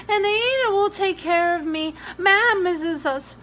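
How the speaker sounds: put-on a small boy insisting through tears; pleading child. A narrator's reading. Jamie pleading, voiced child.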